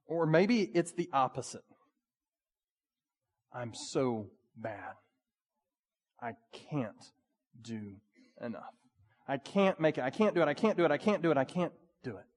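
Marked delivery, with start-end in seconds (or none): 3.5-12.2 despairing inner voice. Retold or imitated. Voicing a despairing believer.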